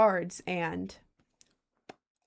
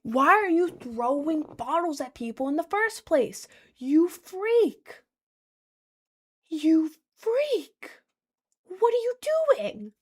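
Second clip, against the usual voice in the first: higher-pitched, exasperated outburst; incredulous rant; 8 semitones higher, slower than usual. Real, not acted. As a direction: scold him like he's unbelievable. Ranting at the guy in disbelief.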